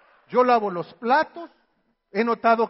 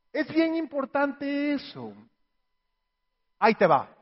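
normal talking voice

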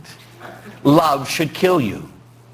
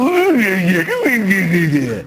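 exasperated groaning; 4 semitones higher, slower than usual. Retold or imitated. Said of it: "look at someone and go".

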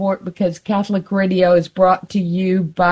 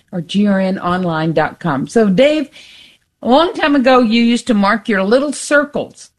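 ordinary talk

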